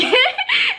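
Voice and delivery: high, loud burst of laughter; cracking up mid-story. Real, not acted. Laughing at her own story, loud high burst.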